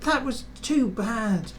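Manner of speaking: high, slow whiny complaint; high hurt carriage voice; complaining coaches. A narrator's reading. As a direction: whine it like bruised carriages. Coaches complaining after bump.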